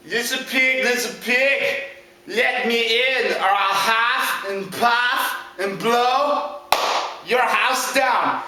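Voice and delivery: slow, deliberate villain demand; drawn-out storybook wolf; threatening big bad wolf. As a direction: stretch out each threat with menace.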